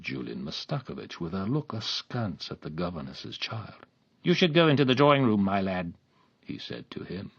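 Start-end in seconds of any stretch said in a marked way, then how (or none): 4.2-5.9 brusque order. A narrator's reading. Man sends boy away.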